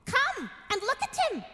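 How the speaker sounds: high eager call to come and look; crying out. A narrator's reading. Twins urging Alice to look at the king, excited call. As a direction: excitedly summon Alice over to the sleeping king. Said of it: "the brothers cried".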